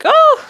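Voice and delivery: loud squeaky "oh", out of it; dazed high "oh". Retold or imitated. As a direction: imitate a floaty, high-pitched gasp. Acts being out of her body.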